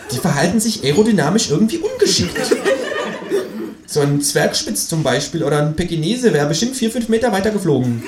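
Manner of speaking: high kangaroo voice; high, critical kangaroo; displeased kangaroo. Acted. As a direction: judge the dog's flight. Kangaroo expert talk.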